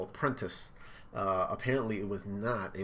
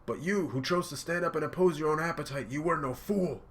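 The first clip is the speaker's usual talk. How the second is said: mild dramatic yell, pitched up; acted screaming; 7 semitones higher, 4 dB louder than usual. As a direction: cry it out as an impassioned character, short of a scream. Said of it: "Oh, that's Pear screaming".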